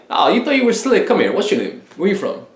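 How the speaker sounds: playful, confident challenge; cocky, unafraid. Acted. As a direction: tease her boldly, then fire off questions. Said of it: "it's almost like you're not afraid".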